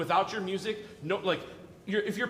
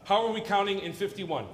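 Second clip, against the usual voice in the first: usual speaking voice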